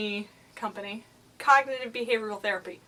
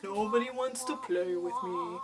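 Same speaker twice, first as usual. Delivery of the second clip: softer, mopey donkey voice; Eeyore; 5 dB quieter than usual. Acted. Inner voice playing the victim, mopey cartoon donkey. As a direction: moan it in glum self-pity. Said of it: "don't make it like the Eeyore".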